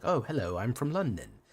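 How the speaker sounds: very clipped London English; clipped London accent. Shown as a demonstration. Streamer imitating how Londoners speak, clipped London accent. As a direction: mimic a Londoner, clipped and precise. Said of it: "Londoners speak in the very clipped kind of way".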